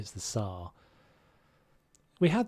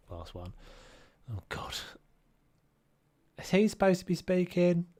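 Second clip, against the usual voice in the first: ordinary delivery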